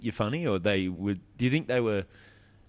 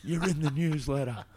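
slow, higher kid voice in mock awe; imitating awed kids; 8 semitones higher, slower than usual. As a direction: play the amazed little boys lightly. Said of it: "Him and his mates are like".

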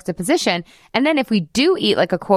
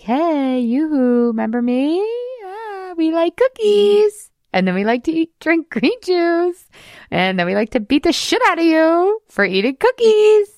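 taunting diet brain; 10 semitones higher than usual. Acted. Diet brain voice coming back to taunt the listener, high sing-song mock. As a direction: sing it out sweetly like a mocking inner voice. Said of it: "She's gonna be like".

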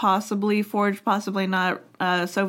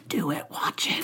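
whispered, softer, coaxing; tempting whisper; 5 semitones lower, 8 dB quieter than usual. Retold or imitated. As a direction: whisper it like curiosity tempting you. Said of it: "my curiosity's like".

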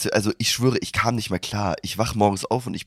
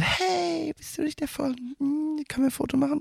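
high, airy mock girl voice, quieter; girl fan voice; 7 dB quieter than usual. Girl on the train asking for a photo, high mock voice. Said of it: "die so".

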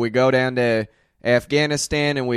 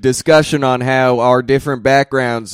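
woke drill sergeant; 2 semitones higher, 8 dB louder than usual. Acted. Sergeant turns soft-spoken.

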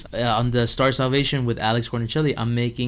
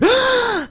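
dramatic gasp; 5 dB louder than usual. Retold or imitated. Mock dropped water scene.

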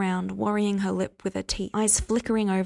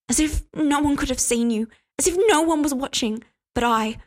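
higher, louder jealous outburst; angry accusation; 6 semitones higher, 4 dB louder than usual. Acted. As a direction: fling the accusation at him. Continuing accusation.

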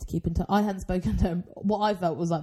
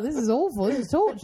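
higher, hammed-up complaint; mock suffering; 6 semitones higher than usual. Friend recalling being starving before late dinner, mock agony. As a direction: wail it lightly as an exaggerated protest. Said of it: "I was like".

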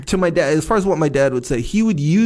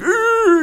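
high straining push-up grunt; strained grunt; 4 dB louder than usual. Retold or imitated. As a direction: grunt like lifting heavy. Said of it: "you're like".

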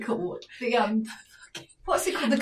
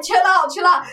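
raised, insistent imitation; urgent pacifying; 5 semitones higher, 6 dB louder than usual. Friend holding boyfriend back, urgent. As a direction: keep urging him to back off.

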